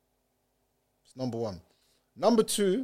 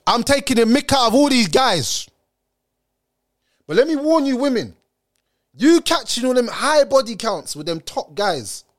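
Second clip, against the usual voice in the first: loud warning; 5 semitones higher, 4 dB louder than usual. Real, not acted. Heated warning to women.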